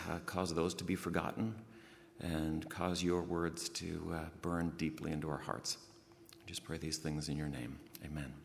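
quiet earnest prayer. Acted. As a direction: pray it gently and reverently, fading toward the amen. Opening prayer before sermon.

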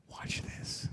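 soft unvoiced whisper. Jesus whispering to the little boy. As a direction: lean in and whisper it close. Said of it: "quietly whispers".

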